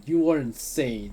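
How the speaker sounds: loud, high disgusted accusation. Acted. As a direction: call them insane. Character accuses narrator.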